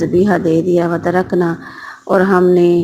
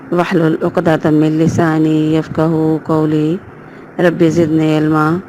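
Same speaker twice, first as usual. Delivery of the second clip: measured, chanted Arabic recitation; Quranic recitation. Acted. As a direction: recite the opening invocation devoutly. Opening Quranic invocations recited.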